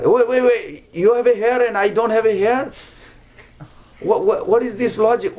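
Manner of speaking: acted baffled protest; incredulous. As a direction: raise the pitch and question the absurd logic. Lord challenges servant's illogical claim.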